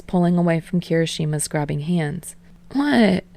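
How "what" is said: whining. Acted. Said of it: "Kirishima whines".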